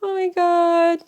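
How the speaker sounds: high, drawn-out tearful voice; real welling-up emotion; starting to cry. Real, not acted. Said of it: "now we're starting to cry".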